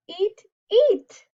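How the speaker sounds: usual speaking voice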